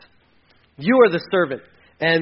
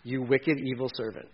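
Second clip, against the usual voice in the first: stern Jesus; 8 semitones lower, 7 dB quieter than usual. Retold or imitated. Jesus rebuking the unforgiving believer, low and stern. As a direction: speak quietly but firmly like Jesus correcting. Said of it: "And Jesus is like".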